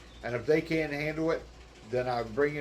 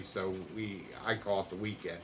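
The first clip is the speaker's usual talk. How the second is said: ordinary delivery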